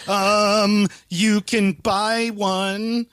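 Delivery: drawn-out, tentative attempt at assertiveness; timidly assertive. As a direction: stretch every word, carefully standing your ground. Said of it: "to, like, be assertive".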